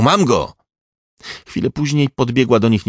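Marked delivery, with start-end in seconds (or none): 0.0-0.5 higher, louder announcing cry; shout. A narrator's reading. Young policeman tells colleagues of the capture, shouting. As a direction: call it out to the whole squad. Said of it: "krzyknął".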